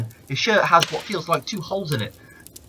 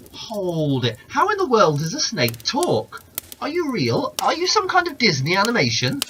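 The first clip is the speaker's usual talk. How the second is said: high, rising, louder disbelieving questions; incredulous man; 5 semitones higher, 3 dB louder than usual. Acted. Man demanding how a snake can talk, incredulous. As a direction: push the disbelief higher with each question.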